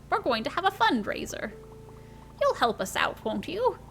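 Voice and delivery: lilting grandmother voice, pitch swooping high; Granny. Acted. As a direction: play a fond, spirited granny fussing over her grandchild. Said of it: "It's Granny!".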